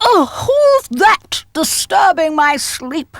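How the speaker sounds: slow, sleepy Father Christmas voice. A narrator's reading. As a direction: wake up grumpy and slow.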